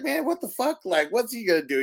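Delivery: high-pitched imitation of a baffled objection; mock incredulous. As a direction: protest like a doubtful onlooker. Retold doubt about the newcomer.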